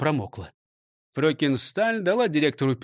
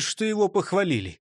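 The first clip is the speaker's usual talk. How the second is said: plain reading voice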